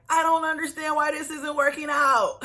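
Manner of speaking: mocking the wife's whiny complaint. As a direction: whine it like the clueless wife. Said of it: "And then she'll say".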